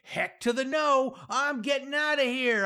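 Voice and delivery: put-on high-pitched kid's yell; defiant yell. A narrator's reading. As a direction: yell a refusal high, on the run. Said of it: "I yell".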